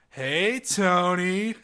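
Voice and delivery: lightly voiced older broadcaster calling out; imitating Phil Simms. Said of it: "he just goes".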